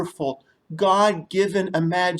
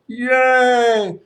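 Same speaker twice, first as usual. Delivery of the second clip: excited cheer; 6 semitones higher, 5 dB louder than usual. Acted. Crocodile cheers.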